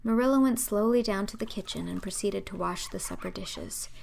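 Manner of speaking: usual speaking voice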